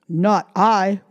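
gruff dog voice; barking dog voice. Acted. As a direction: bark it like a dog. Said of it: "barked the dog".

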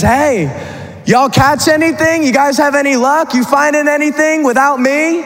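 high, drawn-up hail with rising questions; calling out. Acted. Jesus on the beach to disciples out fishing, calling out. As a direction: holler teasingly across the water. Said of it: "they hear a voice call out to them".

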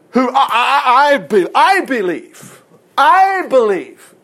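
drawn-out, stammered declaration rising high; mock profession of faith. Preacher aping someone who declares belief, hammed-up conviction.

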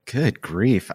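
ordinary talk, nothing marked

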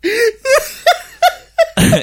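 high crying laughter; shrieking laugh. Real, not acted. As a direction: laugh until it squeaks. Helpless laughter.

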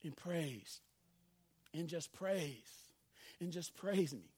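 soft, slow urging to praise; hushed voice of God. Acted. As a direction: let God's plea fall to a hush. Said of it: "And God is like".

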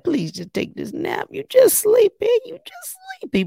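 put-on high coaxing baby-talk voice, swinging way up; soothing a baby. Mother coaxing a fussy baby to nap, high sing-song. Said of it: "and you like".

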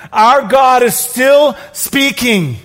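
rising fervent sermon peak; preaching at full force. Real, not acted. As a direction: preach it out to the room.

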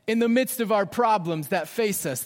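usual speaking voice

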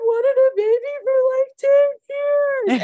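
high, loud, slow, breaking into tears; tearful cry. Retold or imitated. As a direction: sob it out as the dam breaks. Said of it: "And I was like".

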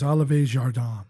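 Olive Jardin said fancy French style; fake French. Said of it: "You can gussy that up all you want".

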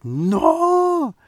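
long, loud, high-pitched mock wail; anguished cry. Reviewer acting out Thor after Thanos leaves, drawn-out cry.

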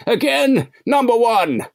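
high falsetto mock sigh at Riker; mock Troi. Mocking Troi addressing Riker, high falsetto. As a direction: ham up the counselor's knowing tone.

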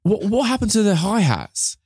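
high, puzzled producer voice; mock producer query. Producer questioning the drummer's hi-hats, high mock puzzlement.

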